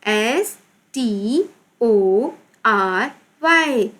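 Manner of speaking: slow, drawn-out letter names; spelling out. Shown as a demonstration. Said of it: "complete right करेंगे". (धीरे-धीरे, खींचकर हिज्जे)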